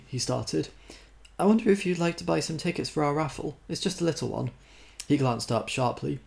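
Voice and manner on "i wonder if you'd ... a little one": lightly raised prim lady voice; a hint of a fussy charity volunteer; sweetly pushy collector; prim raffle woman. A narrator's reading.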